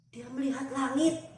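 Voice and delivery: usual speaking voice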